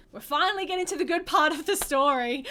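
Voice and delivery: loud, eager build-up to the good bit; excited. Real, not acted. Telling viewers the best part is coming, loud and eager.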